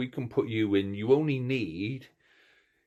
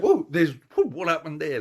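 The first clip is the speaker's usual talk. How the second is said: louder, higher mock startle; a retold jumpy whoa at the noise; startled reaction; 7 semitones higher, 6 dB louder than usual. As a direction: act jolted by the sudden cheering. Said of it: "and you're like".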